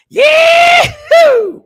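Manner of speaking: huge high whooping yell; wild cowboy holler, high and loud; yahoo shout. Acted. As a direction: let out a rodeo whoop. Hyping the guest's intro.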